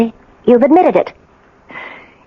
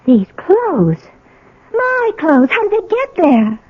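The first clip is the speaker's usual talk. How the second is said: higher, softer, near tears with wonder; tearful awe; 8 semitones higher, 4 dB quieter than usual. Acted. Wife finding her old apartment intact, tearful and awed. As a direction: voice catching as the memories flood back.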